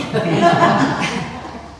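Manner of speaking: a short high laugh. Real, not acted. Laughing after mocking the blame game, high laugh.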